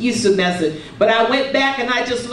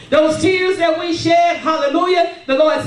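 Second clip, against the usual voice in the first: preaching shout; 5 semitones higher than usual. Real, not acted. Preaching climax.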